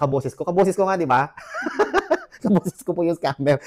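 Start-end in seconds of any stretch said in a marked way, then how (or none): 1.9-3.6 laughing. Real, not acted. Telling viewers the scammer sounds like her, laughing. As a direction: laugh it off while admitting it.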